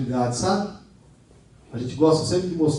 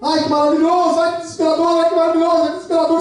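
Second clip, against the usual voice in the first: mock swinging emotions; 11 semitones higher, much faster than usual. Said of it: "Não acontece essas coisas com você?".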